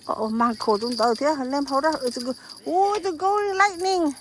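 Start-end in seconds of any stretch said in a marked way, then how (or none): 1.9-4.1 higher and louder with delight; thrilled at the catch; excited shout. Real, not acted. Spotting a golden fish.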